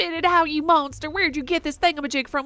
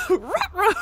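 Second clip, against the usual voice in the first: "Ruh-roh" shouted higher through a laugh; laughing cartoon dog; 8 semitones higher than usual. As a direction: crack up mid cartoon-dog line. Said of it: "pitch perfect Scooby-Doo".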